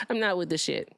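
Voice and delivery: ordinary talk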